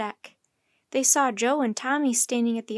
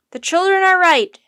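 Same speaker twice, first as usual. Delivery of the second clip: very loud, high declaration; forceful raised shout; 8 semitones higher, 13 dB louder than usual. A narrator's reading. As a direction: shout over the whole crowd. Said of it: "shouted the professor".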